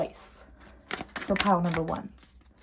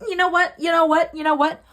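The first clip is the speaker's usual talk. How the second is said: heated repetition; 5 semitones higher, 5 dB louder than usual. Real, not acted. Heated reading.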